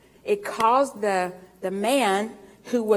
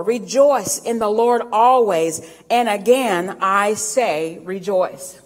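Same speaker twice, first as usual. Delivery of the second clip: slow emphatic scripture; 2 semitones lower, slower than usual. Acted. Preacher quotes Philippians slowly.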